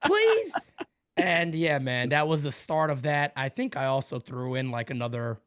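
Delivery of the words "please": put-on high, childlike begging; eager pleading. Asked if he wants the Mario hat, high mock pleading.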